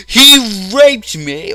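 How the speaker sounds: high, loud, drawn-out accusation; hammed-up, stretched-out wail of accusation; mock shouting. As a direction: imitate an outraged woman yelling.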